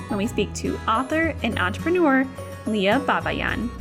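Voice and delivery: upbeat show intro read; podcast host intro. Acted. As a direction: read it like a radio host opening. Podcast intro read over music.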